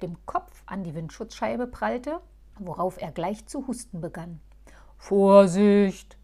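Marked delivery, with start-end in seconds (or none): 5.0-6.2 loud warning cry; calling out. Acted. Moosbart calling a warning from his cooking pot. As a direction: call across from the pot. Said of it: "rief Moosbart".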